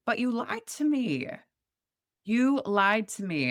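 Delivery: high, pointed you lied to me; hammering the accusation, slowing down; mild accusation. Retold or imitated. Confronting the ex.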